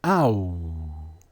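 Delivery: Ow as a long falling glide; low, sustained Ow from the gut; demonstrated "ow" sound. Shown as a demonstration. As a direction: draw out Ow low, ending on "oo". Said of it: "What is the pronunciation that you get".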